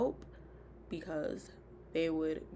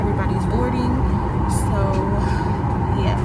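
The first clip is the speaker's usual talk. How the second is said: muffled talking behind a face mask; through a mask; 4 semitones higher, 19 dB louder than usual. Real, not acted. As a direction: speak with the mask over your mouth. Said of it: "You can't hear me through my mask".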